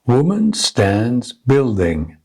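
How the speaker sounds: slow, even beats on "Woman", "stands", "building"; three spaced stresses on "Woman stands building"; rhythm drill. Shown as a demonstration. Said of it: "stressing only the content words".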